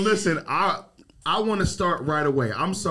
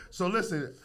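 usual speaking voice

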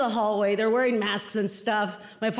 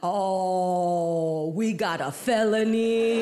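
low, long-drawn gloating announcement; mock excited cop; 6 semitones lower, much slower than usual. Cop totals the gifts for his partner, drawn-out mock thrill. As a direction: savour every word like a prize, stretched out slowly. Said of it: "He's all excited".

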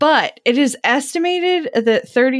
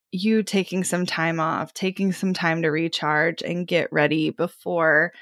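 normal talking voice

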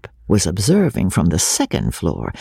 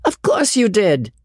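still laughing; 11 semitones higher than usual. A narrator's reading. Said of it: "still laughing".

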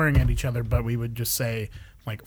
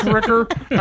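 laughing; 8 semitones higher, 4 dB louder than usual. Acted. Laughing play on swear word.

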